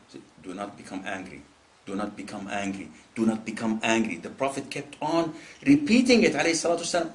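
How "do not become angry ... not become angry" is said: low, hushed, patient repetition; calm counsel. Retold or imitated. Prophet repeating his advice to a man, calm and quiet. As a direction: repeat it softly and steadily.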